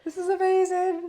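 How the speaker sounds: high, loud thrilled squeal of words; acted delight. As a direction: gush as if reading wonderful news. Acting reaction to a lovely message.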